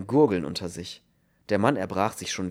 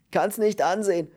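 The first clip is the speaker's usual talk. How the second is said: high, loud, distressed wail; groaned horror; 10 semitones higher, 5 dB louder than usual. A narrator's reading. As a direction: moan that it's unbearable. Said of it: "stöhnte der andere". (entsetzt gestöhnt)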